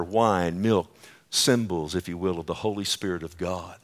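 usual speaking voice

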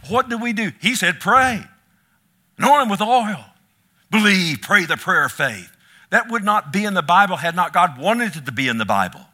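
loud, high preaching voice, hammering each command; preaching at full force. Acted. Pastor preaching James to his church, loud and high. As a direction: preach it out to the congregation with fire.

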